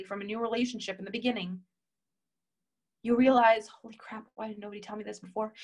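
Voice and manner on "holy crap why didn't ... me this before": sudden soft, fast aside to herself; nearly whispered "holy crap" in disbelief; hushed realization. Retold or imitated. Sudden realization, voiced inner thought.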